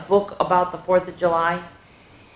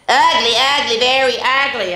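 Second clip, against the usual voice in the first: put-on kids taunting; 7 semitones higher, 4 dB louder than usual. Kids taunting Stephanie again.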